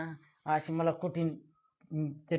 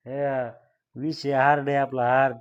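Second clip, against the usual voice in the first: loud, punchy brag; man showing off to the caller; swaggering boast; 9 dB louder than usual. Acted. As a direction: lean hard on hard.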